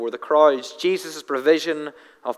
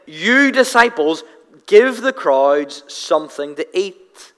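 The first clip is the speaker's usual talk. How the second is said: "You disciples" pitched up, slow and weighty; slow, pointed "You disciples" with "You" raised; stress on you; 3 dB louder, slower than usual. Shown as a demonstration. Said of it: "the you ... is emphatic".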